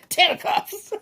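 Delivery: laughing out the card name. Real, not acted. Reader laughing as a card nearly pops out. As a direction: giggle it as the card slips out.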